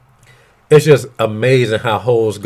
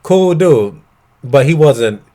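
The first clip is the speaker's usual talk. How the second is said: ordinary talk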